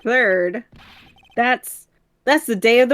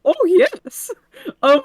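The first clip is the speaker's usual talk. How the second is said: high, goofy cartoon voice; 10 semitones higher, 4 dB louder, much slower than usual. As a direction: play it like a dopey cartoon dog. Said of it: "Can you do Goofy?".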